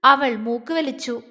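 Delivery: usual speaking voice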